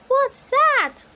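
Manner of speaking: put-on very high, loud little-girl question; high amazed question; curious Peppa. A narrator's reading. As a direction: wonder at the surprise. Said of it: "asks Peppa".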